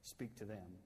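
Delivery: usual speaking voice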